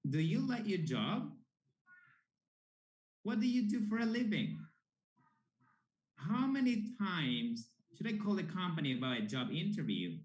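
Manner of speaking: questions with lively rising tune; with intonation. Shown as a demonstration. Teacher modelling questions for students, with rising intonation. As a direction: lift the ends like real questions. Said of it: "You need to add intonation".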